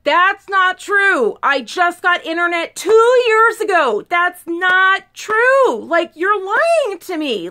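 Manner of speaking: loud, high, drawn-out indignant protest; exaggerated outraged retort. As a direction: throw it back, stretching the words in outrage. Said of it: "of course, I didn't say it that way".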